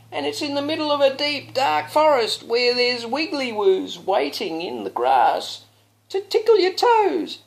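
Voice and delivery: high, loud, slow wailing princess; hammed-up sobbing princess; princess lament. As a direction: wail out her woes dramatically. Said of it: "shrieked the princess".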